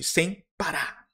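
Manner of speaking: ordinary delivery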